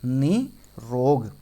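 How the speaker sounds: निरोग very slow, nee... rog; long first vowel. Shown as a demonstration. Teacher drilling the trap for students, slow split nee-rog in निरोग. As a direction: draw both halves of निरोग out, long i first. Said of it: "बड़ी की मात्रा होती है".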